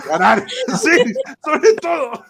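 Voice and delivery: riendo. Real, not acted. Se ríe de la broma.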